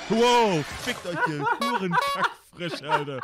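furious shout. Real, not acted. Shocked outburst in game.

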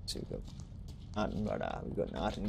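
very soft low muttering; hushed mutter. Real, not acted. Gamer telling his teammate there's nothing here, soft mutter. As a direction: mutter under the breath about the empty room.

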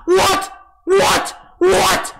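raging gamer. Real, not acted. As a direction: shout in frustrated disbelief. Can't find cube, raging.

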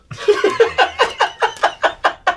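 high-pitched wheezing laugh; squeaky breathless giggle. Real, not acted. Reacts to Darth impression.

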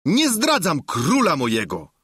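high-pitched squire talking back; defiant high-voiced servant; Sancho, defiant. A narrator's reading. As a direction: answer firmly in a high character voice. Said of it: "odrzekł Sancho".

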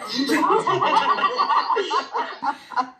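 bright burst of real laughter. Real, not acted. Amused at her own parsnip story, real laughter. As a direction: laugh out at herself.

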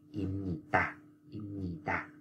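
im nhì tà, soft with a nasal m; model reading. Shown as a demonstration. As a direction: say im nhì tà quietly, m before n. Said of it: "thì bạn phải đọc là".

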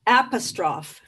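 apostrophe said as AP-uh-stroff; stressed-first pronunciation. Shown as a demonstration. Poet giving the poem's title, apostrophe said AP-uh-stroff. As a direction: end apostrophe on "stroff", no final ee. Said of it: "like apostrophe".

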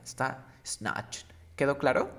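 ordinary delivery

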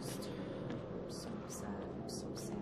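whispered, near tears; sad whisper. Real, not acted. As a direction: whisper it brokenly. Mourning the dog.

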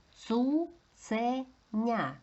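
careful teacher demonstration of each syllable; sounded out. Shown as a demonstration. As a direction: stretch ЦУЦЕНЯ syllable by syllable. Teacher sounds out word for analysis.

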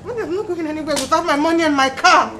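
high-pitched, shouted refusal; upset, insistent wail; loud defiant protest. Acted. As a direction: dig in and yell back. Partner refuses to leave.

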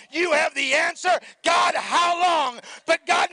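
shouted, high preaching climax; full-force preaching. Acted. Pastor shouting praise to the congregation, full-force preaching. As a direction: build to a roaring, high praise call.

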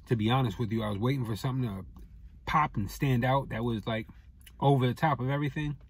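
chewing between words; muffled by food in mouth; mouth full of food. Real, not acted. Seen 1: Bites wrap at -1, then speaks with pursed, working lips.

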